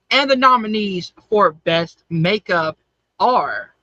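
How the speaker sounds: slow, spaced-out nominee announcement; award announcer. Acted. Host reveals the best makeup nominees to listeners, ceremonial announcer. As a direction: read out the nominees with suspenseful pauses.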